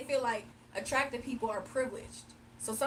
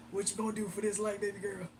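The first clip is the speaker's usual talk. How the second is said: creepy man voice; 5 semitones lower than usual. Retold or imitated. Mocking the viewer's son as a creep. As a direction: lower leering mock voice.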